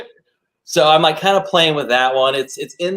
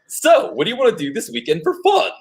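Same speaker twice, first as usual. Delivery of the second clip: higher, lightly voiced teen heartthrob; Ashton Kutcher; 10 semitones higher than usual. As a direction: play it cool like a seventies-show kid. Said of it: "I kinda learned to do this impression".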